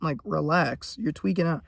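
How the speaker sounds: lightly voiced dismissive critic; imitating a dismissive viewer. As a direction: play a shrugging doubter. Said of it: "you're probably thinking".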